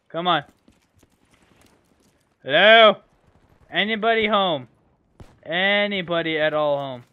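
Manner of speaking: ordinary delivery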